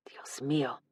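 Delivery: soft worried whisper; hushed Spanish exclamation; whispered worry. Acted. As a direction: breathe it in concern.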